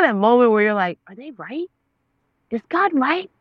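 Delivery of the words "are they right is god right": dawning realization. Retold or imitated. Retold dawning doubt she was wrong.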